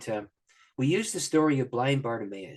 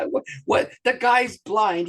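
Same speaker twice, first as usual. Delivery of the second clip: incredulous; 10 semitones higher, 8 dB louder, slower than usual. Real, not acted. Incredulous at the obvious question.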